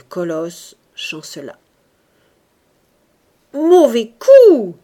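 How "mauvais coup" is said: loud, high, drawn-out shout; crying foul. A narrator's reading. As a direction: call the foul, stretching each word. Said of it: "cria le vicomte Barnard". (cri de protestation, fort et traînant)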